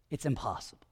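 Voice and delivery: barely voiced, hushed to a breath; whisper. Real, not acted. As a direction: lean in and whisper it. Hushed point in sermon.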